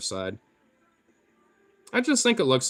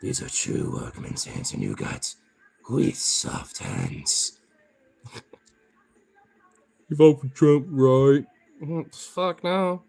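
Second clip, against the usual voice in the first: mock tough guy; slower than usual. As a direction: tease like a lightly played macho brother. Taunting viewer in mock tough voice.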